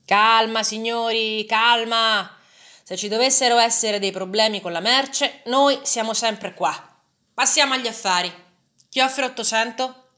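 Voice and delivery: loud, high auctioneer calling for calm; booming merchant. A narrator's reading. Slave merchant calming the buyers, loud and booming. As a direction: settle the crowd and start the bidding. Merchant calms crowd, opens bidding.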